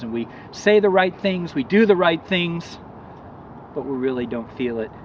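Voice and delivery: ordinary delivery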